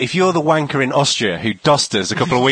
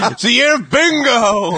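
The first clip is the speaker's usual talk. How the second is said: excited proclamation; 10 semitones higher, 4 dB louder, slower than usual. Real, not acted. Drunk shouted proclamation.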